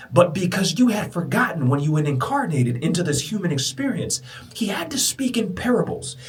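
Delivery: high, insistent preacher's voice; impassioned preaching. Acted.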